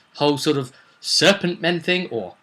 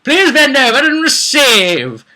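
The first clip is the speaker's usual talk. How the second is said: higher, slow, hammed-up sleazy offer; mock lewd villain; 10 semitones higher, 7 dB louder, slower than usual. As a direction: leer it out slowly, pitched up. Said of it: "gone completely to the canon and said".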